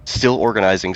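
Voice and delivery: usual speaking voice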